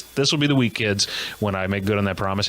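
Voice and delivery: ordinary talk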